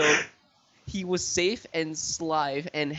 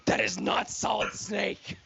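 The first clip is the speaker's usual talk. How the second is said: hammed-up Russian soldier, a little higher; bad Russian accent; 5 semitones higher than usual. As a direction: play the enemy guard in a bad Russian accent. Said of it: "say the line from Metal Gear Solid two".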